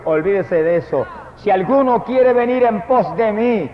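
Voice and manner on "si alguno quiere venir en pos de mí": higher, slow solemn proclamation; preaching scripture. Real, not acted. Preacher quoting Jesus to the church, slow and raised. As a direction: proclaim the verse slowly.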